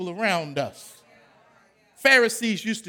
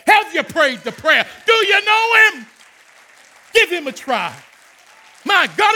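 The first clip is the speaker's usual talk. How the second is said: high, loud fervent testimony; preaching at full force; 6 semitones higher, 5 dB louder than usual. Acted. Pastor testifying at full force to his congregation. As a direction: preach it with fire.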